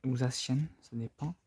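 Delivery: ordinary talk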